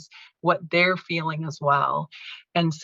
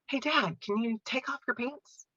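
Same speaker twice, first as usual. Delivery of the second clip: soft, higher-pitched gentle request; gentle coaxing; 6 semitones higher, 7 dB quieter than usual. Retold or imitated. Daughter in the doorway to dad, gently coaxing. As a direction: coax an elderly parent softly. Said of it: "I would just gently say".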